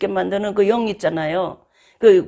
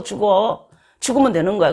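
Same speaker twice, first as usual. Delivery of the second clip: ordinary talk, nothing marked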